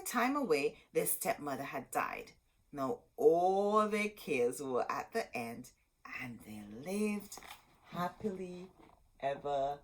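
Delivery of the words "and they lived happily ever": storybook ending. A narrator's reading. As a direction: draw out the happy ending gently.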